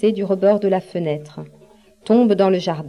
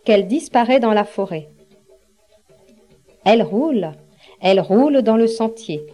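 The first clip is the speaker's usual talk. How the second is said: usual speaking voice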